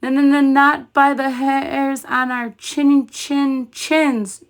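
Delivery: high, stuttering, frightened; scared piglet defying the wolf; trembling. A narrator's reading. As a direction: stammer it out, shaking. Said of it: "trembled Boris".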